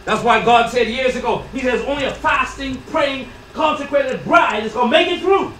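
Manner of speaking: loud, high, ringing exhortation; full-force preaching. Real, not acted. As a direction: call out to wake the congregation.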